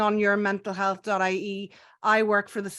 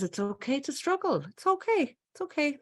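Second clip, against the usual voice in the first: higher, gentle self-soothing voice; kinder inner voice; 7 semitones higher than usual. Pretend. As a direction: comfort yourself like a kind friend. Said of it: "the kinder voice that says".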